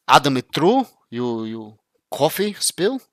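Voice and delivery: mock Russian accent in broken English, slow on the middle line; imitating Russian friends in a Russian accent, rising sharply on Sue. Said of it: "actually in Russia, ... they're like".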